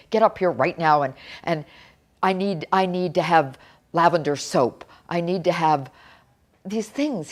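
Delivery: loud mock of a sick father's demands; retold yell. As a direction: bark the orders the way he did. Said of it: "He'd call me and yell at me".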